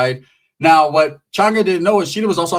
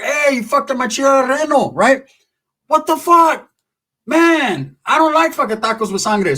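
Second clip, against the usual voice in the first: outraged yell; 9 semitones higher than usual. Acted. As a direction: yell it in disbelief. Yelling at the fight.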